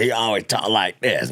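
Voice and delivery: ordinary talk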